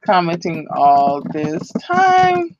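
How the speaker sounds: each word drawn out, checking the spelling; careful, dictating pace; slow spell-check read. Shown as a demonstration. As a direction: read it back slowly, word by word. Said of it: "now I'm spell checking myself".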